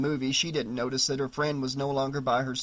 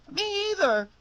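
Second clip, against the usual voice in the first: put-on scared little girl. Girl agrees, frightened.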